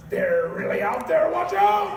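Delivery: a panicked user warning that they're outside; paranoid yell. Retold or imitated.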